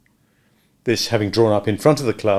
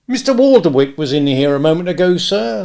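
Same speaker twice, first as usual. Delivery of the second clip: higher, deferential servant voice; a club attendant, respectful and a little louder; attendant's voice; 6 semitones higher, 6 dB louder than usual. A narrator's reading. Attendant reporting to Smith, a touch uneasy.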